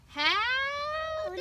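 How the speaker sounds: high, loud cowboy greeting; loud cowboy howdy. Shown as a demonstration. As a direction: belt out the howdy cue. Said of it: "I want you to say".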